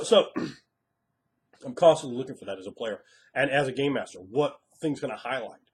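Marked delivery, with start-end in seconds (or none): none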